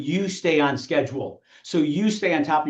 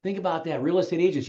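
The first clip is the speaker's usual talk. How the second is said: ordinary delivery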